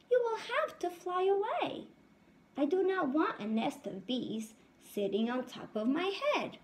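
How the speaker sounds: high squeaky mouse calling out. Acted. Mouse tells the bees on his head to leave, high squeak. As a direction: pipe it up like a small mouse.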